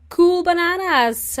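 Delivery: excited. Real, not acted. Cheerful sign-off.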